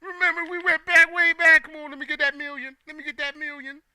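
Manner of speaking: high mock pleading friend; begging old friend. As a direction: beg like a long-lost buddy for money. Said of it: "all you guys are gonna be like".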